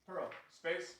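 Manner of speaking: a flat statement, not a question; firm command. Shown as a demonstration. Trainer shows the space cue to the dog. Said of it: "you can tell her space".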